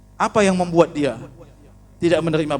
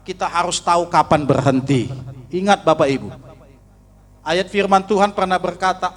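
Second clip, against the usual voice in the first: usual speaking voice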